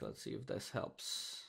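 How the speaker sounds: breathy whisper under the breath; whispered aside. Real, not acted. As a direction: mutter it to yourself in a whisper. Muttered aside while restarting server.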